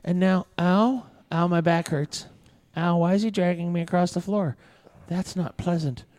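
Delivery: idly notice things through a daze; dazed thoughts. Acted. Game master voices Titus dragged across the floor, dazed. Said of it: "you think to yourself".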